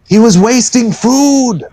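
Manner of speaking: very high, slow tattling voice. Acted. Bathsheba accuses George, very high slow voice. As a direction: squeak out the charge slowly. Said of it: "and she goes".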